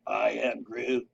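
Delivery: low, soft, very slow Groot voice. Retold or imitated. Comparing a silent man to Groot, slow low mock voice. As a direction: rumble it out slow like the tree creature. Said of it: "He could have, like, been Groot".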